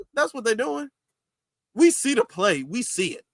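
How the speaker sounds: ordinary talk, nothing marked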